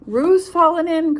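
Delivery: cried out. Acted. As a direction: raise the alarm. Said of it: "cried Rabbit".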